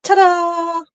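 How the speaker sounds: bright, loud, high ta-da; ta-da reveal. Real, not acted. Showing off her finished stitching to the camera, loud high reveal. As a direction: present it with a flourish.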